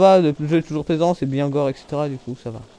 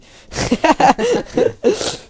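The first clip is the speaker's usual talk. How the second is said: loud laugh; 11 semitones higher, 7 dB louder than usual. Real, not acted. Big laugh at own joke.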